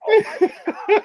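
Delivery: very high, loud mock shriek; retold shriek. Man answering the startled lady, retold shriek. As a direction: shriek it comically, breaking into laughter. Acting out his answering shock.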